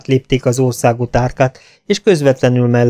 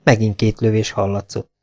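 ordinary delivery, nothing marked

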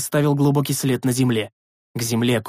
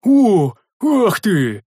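higher, louder burned yelp; yelp of pain; 7 semitones higher, 6 dB louder, much slower than usual. A narrator's reading. The big man yelps at the burning axe, pained.

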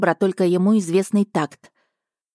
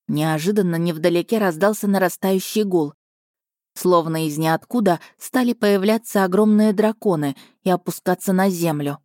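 ordinary delivery, nothing marked

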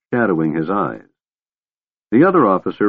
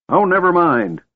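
put-on high-pitched, testy old man; irritable snap; 10 semitones higher than usual. A narrator's reading. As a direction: brush it off irritably. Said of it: "snapped the old man irritably".

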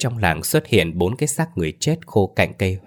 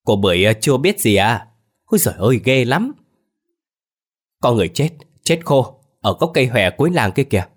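higher, tart village gossip; tart, raised gossiping tone; sour-voiced gossip; 4 semitones higher, 4 dB louder than usual. A narrator's reading. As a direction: spread shocking news in a sour voice. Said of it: "dùng cái giọng chua loét của mình mà nói".

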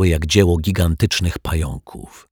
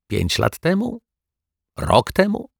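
much higher, probing questions; incredulous guessing; 7 semitones higher than usual. A narrator's reading. Detective pressing Mrs Majewska for a date, high and incredulous.

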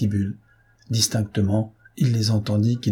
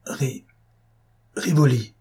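broken, halting stammer, raised a little; 4 semitones higher than usual. A narrator's reading. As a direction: stumble on the first syllable, then get the name out. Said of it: "Altenheim bégaya, comme hypnotisé". (bégaiement hésitant, comme hypnotisé)

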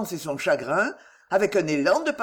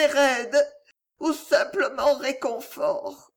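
désespérée; 6 semitones higher than usual. A narrator's reading. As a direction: beg him desperately, close to crying. Distraught wife begging for help. (supplication désespérée, au bord des larmes)

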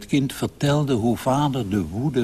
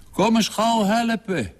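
high, urgent father's call; 13 semitones higher than usual. A narrator's reading. Father shouts to his daughter for help, calling out high. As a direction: call for help in a sharply raised pitch. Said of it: "die riep".

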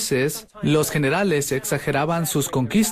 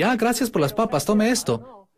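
lightly raised, sweet mock-polite teen; 7 semitones higher than usual. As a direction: act the mannerly kid thanking for the food. Mimics polite teen at dinner. (adolescente educado fingido, tono un poco más agudo)

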